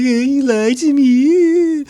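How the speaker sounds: drawn-out Donna accusing; imitating Donna. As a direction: Donna hurt, voiced high and slow. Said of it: "she's like".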